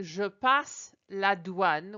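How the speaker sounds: very slow French phrase; careful French model. Shown as a demonstration. Teacher shows customs phrase to travelers, slow French. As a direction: say "Je passe la douane" slowly with a clear rise. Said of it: "I would say".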